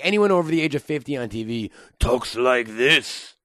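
ordinary delivery

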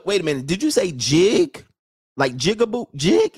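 normal talking voice

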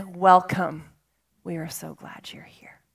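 warm welcome dropping to a whisper; welcoming love. Retold or imitated. Voice of love speaking.

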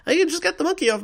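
very high helium squeak; exaggerated helium-voice imitation. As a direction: squeak it way up high, mocking a spunky bandmate. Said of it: "in your [name] impersonation".

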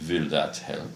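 ordinary talk

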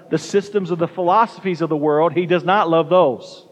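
normal talking voice

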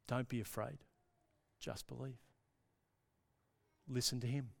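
low, very soft, with long pauses; hushed reassurance. Real, not acted. Preacher tells listeners not to be afraid, softly reassuring. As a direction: reassure gently, almost under the voice.

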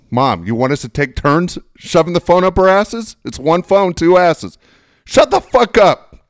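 high, loud fed-up retort; mock angry sister. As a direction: sister shouting back at mother, mock fed-up. Sister yelling back, retold.

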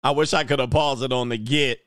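normal talking voice

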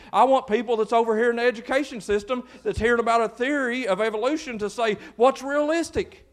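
loud, high preaching push; full-force preaching. Acted. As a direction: preach it out to the whole room, building.

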